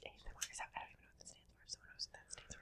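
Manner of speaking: barely audible whisper; tiny, voiceless ASMR whisper. Pretend. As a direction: whisper almost under the level of hearing. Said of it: "When you whisper".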